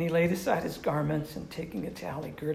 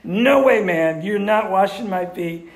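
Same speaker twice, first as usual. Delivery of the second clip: very loud, high-pitched acted protest; emphatic refusal; 3 semitones higher, 11 dB louder than usual. Preacher paraphrases Peter refusing Jesus, loudly emphatic. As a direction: belt out the refusal, easing slightly after the first words. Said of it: "it's emphatic and great. He's saying".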